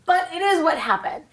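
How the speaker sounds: ordinary delivery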